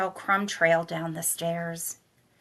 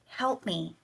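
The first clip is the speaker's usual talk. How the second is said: faint plea; 6 dB quieter than usual. Acted. Sister bleeding, pleading.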